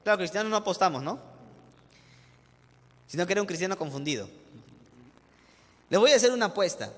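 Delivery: ordinary talk, nothing marked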